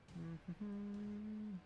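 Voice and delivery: a high, soft giggle. Real, not acted. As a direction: let out a quick high chuckle. Laugh while playing.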